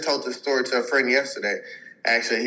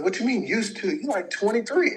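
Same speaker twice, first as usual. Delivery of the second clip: higher mock patient voice; 8 semitones higher than usual. Patient voiced in retold story.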